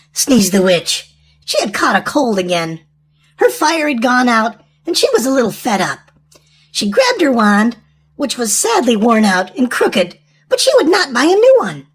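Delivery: usual speaking voice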